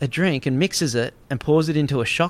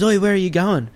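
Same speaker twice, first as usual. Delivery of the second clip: higher-pitched, imitated challenge; confronting challenge; 7 semitones higher than usual. Xander accosts the drugged man crawling away, confronting. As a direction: call out to stop someone.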